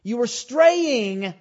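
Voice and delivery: high, loud preacher's push; full-force preaching. Acted. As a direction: drive it home to the congregation.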